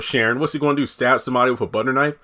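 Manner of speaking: ordinary talk, nothing marked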